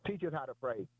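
low, measured recital of the Lord's Prayer. Acted. Preacher reciting the Lord's Prayer to the congregation, reverent and measured.

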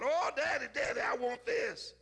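put-on child begging. Preacher voicing little Fred begging his daddy for toys, mildly pleading. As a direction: beg like an excited kid in a store.

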